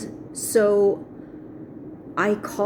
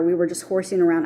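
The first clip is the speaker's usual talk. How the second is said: ordinary delivery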